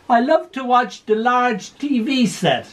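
put-on high, far-off little old lady; a dotty gran delighted with her TV. Confused old gran in care.

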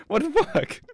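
high squeal of incredulous laughter; helplessly amused, high-pitched outburst; laughing disbelief. Real, not acted. Laughing at units misbehaving.

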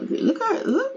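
ordinary talk, nothing marked

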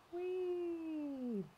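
soft, high, drawn-out squeal; playful "whee". Pretend. Host showing off sparkly fabric, soft playful whee. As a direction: let out a small gleeful whee.

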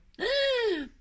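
high, sharp gasp of delight; real high-pitched intake; admiring gasp. Real, not acted. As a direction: gasp loudly at a lovely surprise. Gasps while admiring the gift.

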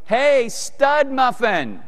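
high, drawn-out wifely call; mock calling out. Wife calling to her husband from another room, high mock yell. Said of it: "my wife yells in from the other room".

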